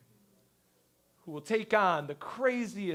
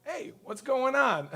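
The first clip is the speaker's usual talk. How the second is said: higher, drawn-out greeting; slow, high-pitched hello of recognition; delighted recognition; 7 semitones higher than usual. Acted. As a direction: greet a voice you suddenly recognize. Recognizing the caller's voice.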